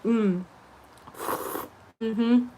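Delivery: slurping noodles while talking. Real, not acted. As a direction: talk between slurps of noodles. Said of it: "Don't mind me slurping my noodles".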